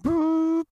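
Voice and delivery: imitated high electronic boop; sudden high-pitched beep sound. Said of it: "And then it went".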